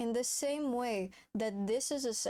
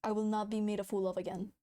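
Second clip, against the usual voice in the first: ordinary talk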